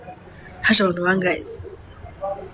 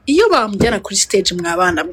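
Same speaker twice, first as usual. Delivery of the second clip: normal talking voice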